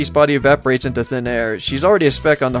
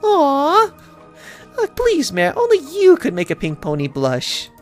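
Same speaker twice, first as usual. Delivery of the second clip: high, cutesy bashful pony voice; 11 semitones higher than usual. Acted. Pinkie flattered by the mayor, bashful.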